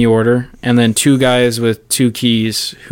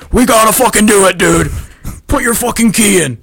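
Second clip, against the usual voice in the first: high, loud, pushy orders; frantic yell; 9 semitones higher, 5 dB louder than usual. Retold or imitated. Officer yelling at partner to launch, frantic. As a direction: shove your partner to turn the key.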